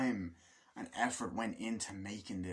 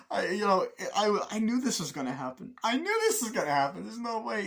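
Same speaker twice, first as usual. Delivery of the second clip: laughing quote; 9 semitones higher, 5 dB louder than usual. Retold or imitated. Characters laughing at burning car.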